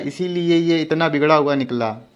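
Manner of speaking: ordinary delivery, nothing marked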